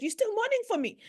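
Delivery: high-pitched, challenging retold question; tough-love mother. Mother to daughter in a dream, imitated tough love. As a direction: imitate a mother chiding her grieving daughter. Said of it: "she gave me what we call tough love".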